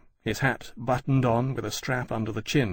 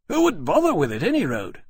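higher, louder challenge; dismissive rhetorical question; 6 semitones higher, 5 dB louder than usual. Acted. Aviator dismissing the idea of a forgery, higher and louder.

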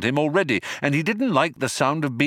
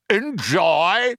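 high, booming incredulous question; 8 semitones higher, 4 dB louder than usual. Acted. Baffled Vogon guard answering Ford, booming. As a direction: boom it out with a rising end. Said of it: "he boomed".

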